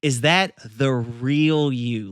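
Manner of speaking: slow, weighted line with REAL stretched out; stress on REAL. Acted. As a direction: hold and emphasize the word "real". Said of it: "the emphasis on real".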